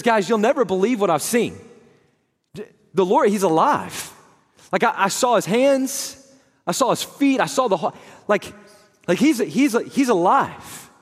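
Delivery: high, loud, tumbling excitement; breathless Mary. Retold or imitated. Mary, out of breath, tells the disciples he's alive. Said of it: "she says".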